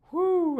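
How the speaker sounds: loud high whew of hype; fired-up whew; excited whoop. Real, not acted. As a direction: let out a pumped-up whoop. Hyped up by the band.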